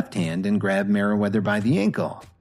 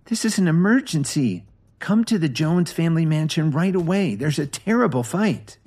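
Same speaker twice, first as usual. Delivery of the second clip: urgent phone call; 7 semitones higher than usual. Acted. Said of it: "Lily's voice trailed off".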